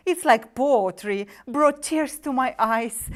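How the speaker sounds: ordinary delivery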